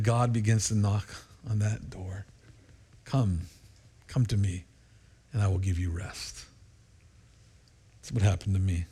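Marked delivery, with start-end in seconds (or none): 3.0-6.4 gentle call. Pretend. God calls quietly.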